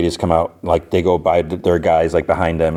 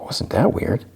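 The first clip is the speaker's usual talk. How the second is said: hushed, half-whispered question; retold quiet remark, nearly voiceless; quiet aside; 5 semitones higher, 4 dB quieter, slower than usual. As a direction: say it under your breath, as if only for one person. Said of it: "quietly, she's like".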